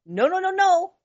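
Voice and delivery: high, rapid string of no's; high-pitched playful no-no-no; playful refusal. Real, not acted. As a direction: wave it off laughing. Joking refusal.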